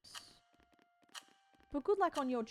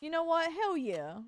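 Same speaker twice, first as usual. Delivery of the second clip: sassy; 2 semitones higher, 4 dB louder, slower than usual. Retold or imitated. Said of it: "I'm like".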